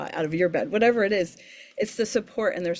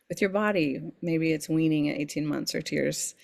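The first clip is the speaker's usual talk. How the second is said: ordinary delivery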